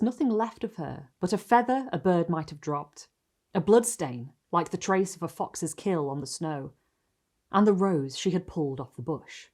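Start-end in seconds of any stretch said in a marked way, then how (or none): none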